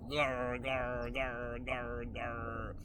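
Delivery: low, soft growling gulps; mock chugging growl. Storyteller imitating the girl chugging vodka, soft growl.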